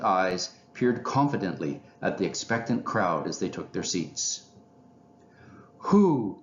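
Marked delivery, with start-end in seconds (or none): 5.8-6.4 loud, raised commanding hoot. A narrator's reading. Owl lecturer opening to his audience, commanding. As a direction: call it out with authority. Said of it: "he said commandingly".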